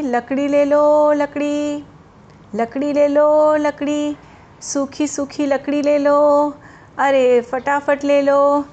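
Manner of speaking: loud, slow hawker's call; street vendor calling. Retold or imitated. Woodseller calling loudly outside the house, slow hawker cry. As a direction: cry your wares down the lane, drawn out. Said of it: "आदमी की ज़ोर ज़ोर से चिल्लाने की आवाज़".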